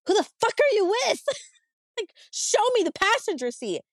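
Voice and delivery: high, fast, furious demand; furious yell. Retold or imitated. Wife yells at her cheating husband on the phone, furious.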